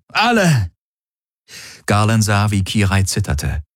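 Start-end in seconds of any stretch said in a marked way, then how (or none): 0.1-0.7 high, sharp one-word command; barked order. A narrator's reading. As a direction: bark it at the whole group. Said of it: "bellte Tari".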